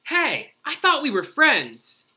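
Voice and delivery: high, loud indignant cry; hurt protest. Acted. A friend protesting Groundhog's forecast, high and loud. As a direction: wail it like a betrayed friend.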